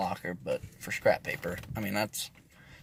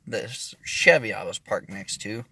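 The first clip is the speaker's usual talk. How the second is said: ordinary talk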